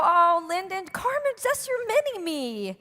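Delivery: high, cooing mock visitor voice. People seeing her daughter and calling her a mini-me, cooing. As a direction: gush like a charmed stranger.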